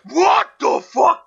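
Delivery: slow, loud outraged yell; shocked shout. Real, not acted. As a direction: blurt it out in shock. Sudden outburst while gaming.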